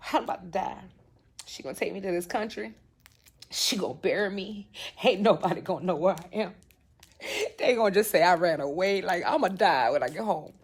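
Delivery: low, soft inner monologue; dread. Retold or imitated. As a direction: think aloud, expecting doom. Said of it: "I'm looking at it like".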